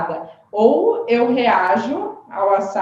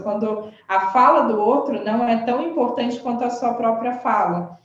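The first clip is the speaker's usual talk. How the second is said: ordinary talk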